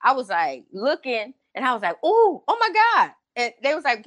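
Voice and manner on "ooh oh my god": high, a bit louder mock thrill; thrilled gasp. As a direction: squeal at the sight. Reaction watching injection.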